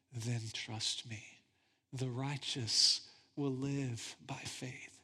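gently calm a frightened friend; tender God. Acted. God's tender reply.

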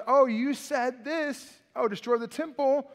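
preacher voicing the mocking crowd at the cross; jeering mockery. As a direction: taunt him like a sneering passerby. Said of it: "the people walking by are mocking him".

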